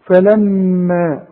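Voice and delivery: فلما slow, with a long nasal hum on م; held ghunnah. Shown as a demonstration. Teacher shows the shadda rule, فلما with long nasal م. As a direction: hum the doubled meem in فلما for two counts. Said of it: "غنها بمقدار حركتين".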